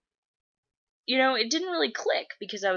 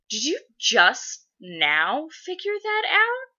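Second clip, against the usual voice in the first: slow, high, loud disbelief; incredulous mom; 9 semitones higher, 6 dB louder, slower than usual. Retold or imitated. Mom realizing her daughter only now understood, incredulous. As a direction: spell out mock disbelief word by word.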